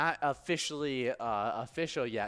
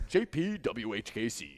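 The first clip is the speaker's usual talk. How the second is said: radio DJ voice. Shown as a demonstration. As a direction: call letters like a station ID. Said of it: "I just like saying it like a radio".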